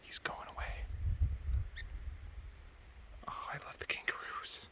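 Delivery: hushed whisper; wildlife watching whisper. Real, not acted. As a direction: whisper so you don't scare it. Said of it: "Trying to be quiet so we don't scare him".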